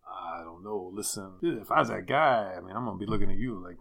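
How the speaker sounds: imitated thick Italian accent, animated. [name] answering the lady's question, in a mock Italian accent. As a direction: play the adviser with a hammed-up Italian accent. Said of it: "in a thick Italian accent".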